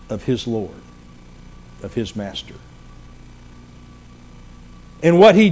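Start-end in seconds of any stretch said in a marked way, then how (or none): 0.1-2.5 low, soft phrases set apart by pauses; solemn hush. Real, not acted. Preacher telling of the death of Peter's Master, solemn hush. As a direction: let each phrase sink lower and softer.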